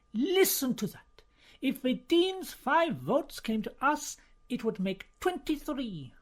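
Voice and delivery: a scheming cardinal counting votes with relish; eager plotting cardinal. Acted. Cardinal urging dinner companions to back Bellini, eagerly insistent.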